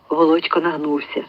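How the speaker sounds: ordinary delivery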